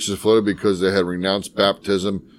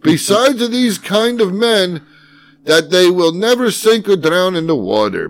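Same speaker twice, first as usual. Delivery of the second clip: mock archaic reading; 9 semitones higher, 5 dB louder than usual. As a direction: ham up the old text like a town crier. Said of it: "reading old text in squeak".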